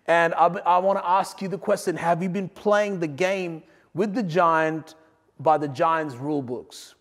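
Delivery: normal talking voice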